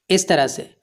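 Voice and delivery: ordinary talk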